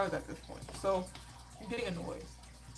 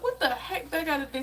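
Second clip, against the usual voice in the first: mildly indignant, higher-pitched comeback; indignant retort; 7 semitones higher, 4 dB louder than usual. Retold or imitated. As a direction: push back, pitched up in mock protest. Retold argument with the nurse.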